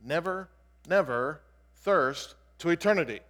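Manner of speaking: "Never, never" punched loud, each word set apart by a pause. Shown as a demonstration. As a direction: hammer "Never", "never", "thirst", then "to eternity", one at a time. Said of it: "the Greek is much more emphatic".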